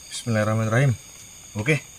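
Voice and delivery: ordinary delivery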